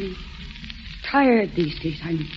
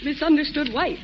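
normal talking voice

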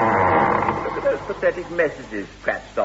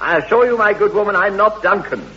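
loud, raised, indignant denial; exasperated protest; 7 semitones higher, 8 dB louder, faster than usual. Acted. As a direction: insist loudly, losing patience with the operator. Caller protests mistaken identity.

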